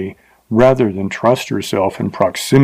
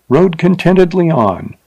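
ordinary delivery

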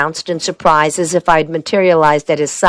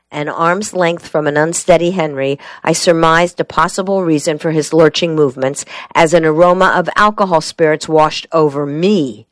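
ordinary delivery

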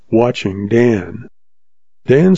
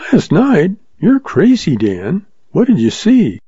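startled June; 7 semitones higher than usual. Acted.

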